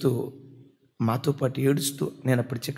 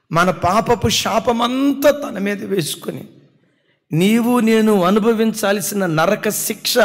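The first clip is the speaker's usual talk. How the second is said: fervent sermon cry; full-force preaching; 4 semitones higher, 6 dB louder than usual. Acted. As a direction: proclaim it to the congregation with fervour. Preaching climax.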